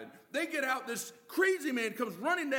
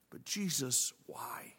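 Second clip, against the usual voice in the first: whispered, softer question; hushed whisper; 10 semitones lower, 4 dB quieter, slower than usual. Retold or imitated. Disciples quietly questioning Jesus' choice, whispered. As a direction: breathe the question in disbelief.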